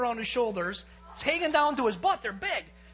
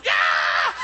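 loud imitated squeal; 11 dB louder than usual. Partner wrestling the turkey, imitated loud squeal. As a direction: let out a big wild cry. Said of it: "Brett is squealing".

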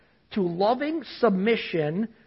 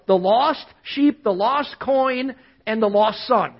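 slow, deliberate list; slower than usual. Acted. Listing the three parables slowly.

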